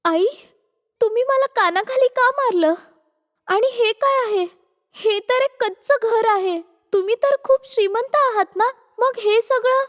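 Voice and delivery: put-on young wife, confused and wronged; bewildered daughter-in-law.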